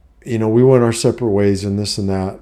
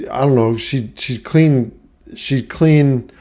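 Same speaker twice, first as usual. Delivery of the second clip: ordinary talk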